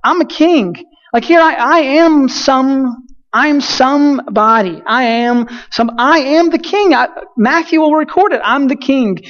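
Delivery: hammed-up indignant Herod; a put-out king insisting on his title. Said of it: "Herod's standing there like".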